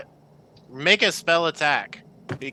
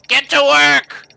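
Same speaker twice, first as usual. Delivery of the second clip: much higher, loud shout over the shoulder; barked order; 14 semitones higher, 9 dB louder than usual. Acted. Grumpy dwarf yelling at the crew as he leaves, angry shout. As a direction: yell it as he storms off. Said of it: "looking back and saying".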